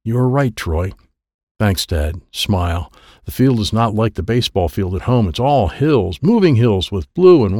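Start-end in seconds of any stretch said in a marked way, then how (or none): none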